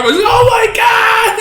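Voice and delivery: high, drawn-out mock scream; freaking out. Man still alive as they start cutting him up, freaking out. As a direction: wail it way up high in acted horror. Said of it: "He's freaking out".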